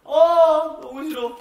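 loud high whine; loud protest. Real, not acted. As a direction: protest loudly. Reacting to being teased.

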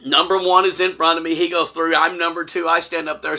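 ordinary delivery

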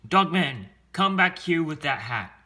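loud, high angry call; shouting after someone. Acted. Mayor to the dog running off with a hat, angry shout. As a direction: yell after the runaway dog.

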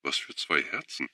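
a muffled, teary question; tearful mumble. A narrator's reading. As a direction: ask while wiping away tears. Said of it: "fragte undeutlich".

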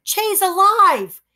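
high, slow joyful cry; excited boy. Acted. Boy rejoicing that the parrot lives.

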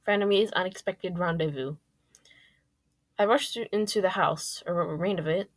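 usual speaking voice

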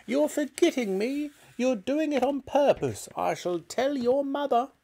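put-on high, demanding old woman's voice; grumpy granny hollering for her medicine; cranky grandma calling. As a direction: call out like a bossy old granny. Grandma scolds George.

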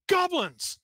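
high startled yell, falling away; Gunnar's startled shout. Acted. Wagon driver spotting goblins on the road, startled shout. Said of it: "he's gonna shout".

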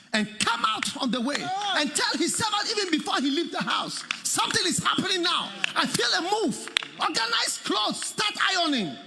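high, loud, joyful father calling out; exultant preacher voice; preaching at full force. Acted. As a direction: shout the good news to the servants.

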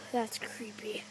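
scared hushed whisper. Pretend. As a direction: breathe it out, spooked. Scared whisper in creepy place.

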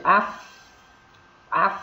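"aff" stopped on a breathy f; held final F. Shown as a demonstration. Teacher isolating the F, "aff" ending in released breath. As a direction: halt on the f of "aff" and let air out. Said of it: "chúng ta dừng lại ở đấy".

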